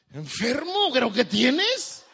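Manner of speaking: high, loud, incredulous scolding; hammed-up outraged parent, stretching the last words; exasperated parent. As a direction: yell it in disbelief at your child. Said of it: "¿qué le dices?".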